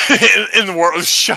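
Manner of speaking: laughing. Real, not acted. Mocking a slip with laughter.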